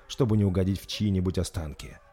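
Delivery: ordinary delivery, nothing marked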